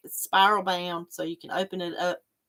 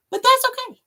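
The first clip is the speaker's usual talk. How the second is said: high chirpy; 7 dB louder than usual. Real, not acted. Brushing off a flop.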